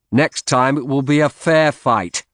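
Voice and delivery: shouting after him, high and loud; a defiant shout at a departing rival. A narrator's reading. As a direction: call it out after him as he goes. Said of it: "I shouted after him".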